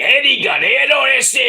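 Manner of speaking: high, hammed-up Mexican-American accent. Echoes his Eddie impression.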